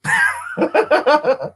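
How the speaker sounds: high-pitched giggling laugh. Real, not acted. Laughs at own joke.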